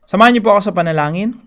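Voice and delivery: ordinary talk, nothing marked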